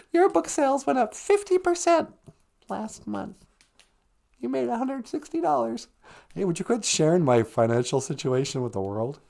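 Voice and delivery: ordinary talk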